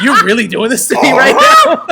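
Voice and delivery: loud, high retold disbelief; hammed-up wounded protest; mock exasperation. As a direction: imitate a fed-up customer, laughing. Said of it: "he sat there for a second, like".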